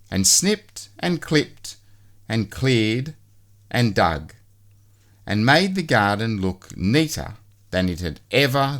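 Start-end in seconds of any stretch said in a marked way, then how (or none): none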